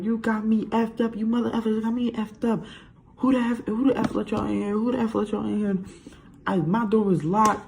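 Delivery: high-pitched mock angry mom; indignant rant in a put-on high voice; cursing someone out. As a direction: imitate an outraged mother telling someone off. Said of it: "now she cursing out the cops".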